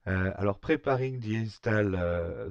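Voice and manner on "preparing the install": slow English with a strong French accent; real French accent on English words, louder. Real, not acted. Tutorial host reading English instructions to viewers, French-accented English. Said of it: "mon accent anglais déplorable".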